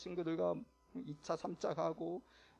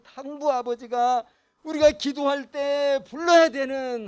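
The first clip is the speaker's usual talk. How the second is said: full-force preaching; 6 semitones higher, 14 dB louder than usual. Acted. Preacher proclaiming God as the prodigal father, at full force. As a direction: proclaim it to the congregation.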